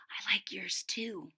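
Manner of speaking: soft unvoiced whisper. Angela whispering to Ruby in class, hushed. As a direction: breathe it secretly across the desk. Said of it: "whispered Ruby".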